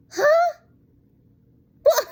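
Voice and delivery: sudden high, loud huh; a loud, high incredulous huh; startled huh. Real, not acted. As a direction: jolt up in disbelief. Reacting to the odd line reading.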